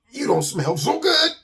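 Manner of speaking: high, squeezed admission; strained confession. Acted. Bird breaks the news to the smelly skunk, strained. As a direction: blurt it through the stench.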